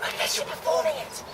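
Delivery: hushed stage whisper. Acted. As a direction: whisper it like a secret.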